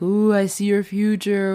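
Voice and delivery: like a fortune teller gazing into a crystal ball. Retold or imitated. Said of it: "fortune teller's got this crystal ball".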